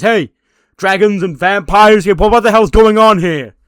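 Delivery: loud, high disbelieving yell; exasperated shout. Retold or imitated. Johnny yelling at the dragon video, loud and high. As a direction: shout in baffled outrage.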